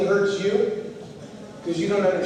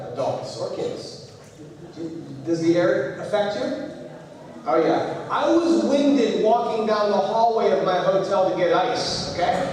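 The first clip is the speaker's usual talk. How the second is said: normal talking voice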